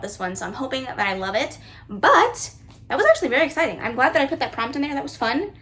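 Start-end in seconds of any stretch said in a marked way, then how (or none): none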